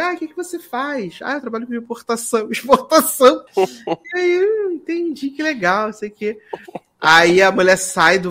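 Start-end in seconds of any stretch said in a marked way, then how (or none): 0.0-6.4 high flirty voice. Retold or imitated. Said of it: "e aí Audrey".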